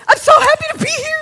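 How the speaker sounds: high, loud and fast gushing voice; imitated gushing. Quoting the stranger who hugged her, high gushing. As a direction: gush it out like an overjoyed newcomer. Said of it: "she goes".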